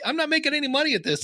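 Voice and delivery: high, fast, whiny client; mock whiny complaint. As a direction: whine it like a let-down client. Client complains after two months.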